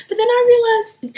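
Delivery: ordinary talk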